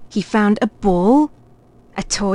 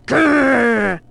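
long rolled "grrr"; storybook bear's growl; teddy growl; 3 semitones higher than usual. A narrator's reading. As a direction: growl like a toy bear. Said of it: "growled Teddy".

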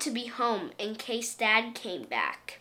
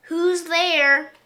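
loud, higher call through the door; 6 semitones higher, 8 dB louder than usual. Acted. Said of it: "a voice called out".

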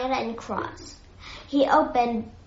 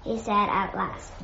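ordinary delivery

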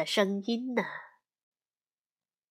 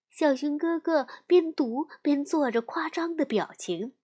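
usual speaking voice

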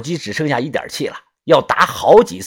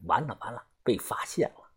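terrified whisper; 3 semitones lower, 13 dB quieter than usual. Acted. Inner thought, frozen in fear.

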